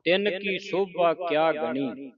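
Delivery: measured recitation with drawn-out line endings; scripture recitation. Shown as a demonstration. Teacher reads scripture for students to follow, measured recitation.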